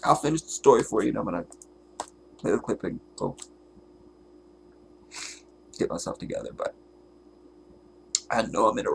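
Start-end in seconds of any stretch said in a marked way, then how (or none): none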